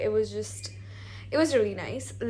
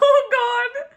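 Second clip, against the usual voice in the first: laughing; 8 dB louder than usual. Real, not acted. Tasting friend's pina colada.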